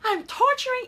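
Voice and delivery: high whiny mock complaint; imitating herself complaining, high and whiny; complaining to husband. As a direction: whine it like she does to her husband. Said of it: "I'm complaining to my husband".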